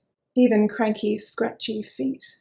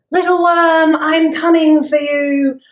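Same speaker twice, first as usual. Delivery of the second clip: loud menacing villain leaf; evil leaf; 7 semitones higher, 11 dB louder than usual. Pretend. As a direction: loom over the worm. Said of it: "I'm an evil leaf".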